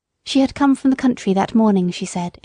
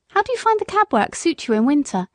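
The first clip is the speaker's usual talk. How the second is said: higher, lilting lady's voice; concerned gentlewoman; 6 semitones higher than usual. A narrator's reading. Lady asking the cabman about winter work, kindly concerned. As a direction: inquire kindly on a raised pitch.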